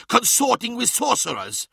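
high-pitched scandalised accusation; indignant outburst; crying out. A narrator's reading. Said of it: "cried Skarsen".

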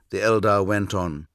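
ordinary delivery, nothing marked

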